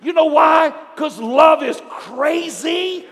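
loud, high, drawn-out preacher's cry; full-force preaching. Real, not acted. Preacher telling the congregation God loves them, shouting fervently. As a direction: build to a shouted exhortation.

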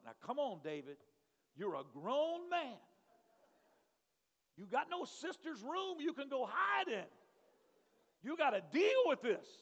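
loud, high scolding of himself; loud mock self-scolding; self pep talk. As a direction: talk yourself into courage, loudly. Scolding himself in his head.

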